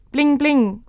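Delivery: high, loud chiming bling; chiming thought. Acted.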